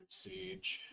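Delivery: ordinary talk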